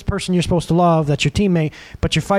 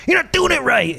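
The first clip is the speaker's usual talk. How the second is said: mock nag; 8 semitones higher than usual. Nagging about mowing.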